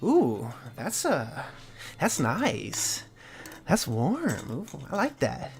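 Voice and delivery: savouring, swooping oohs; drawn-out, softly delighted purr of approval; Bastion. Acted. GM as Bastion reacting to the warm glowing shield, savouring. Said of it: "you hear Bastion's voice go".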